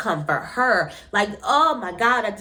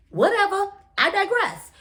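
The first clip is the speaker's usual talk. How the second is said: higher, airy brush-off; dismissive whatever; 11 semitones higher than usual. Real, not acted. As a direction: wave the whole thing away. Dismissive shrug off.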